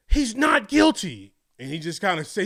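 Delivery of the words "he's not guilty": loud, high, drawn-out shout of the verdict; retold yell. A kid bursting into class yelling the verdict. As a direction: call out the news to the whole room. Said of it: "ran in and yelled out".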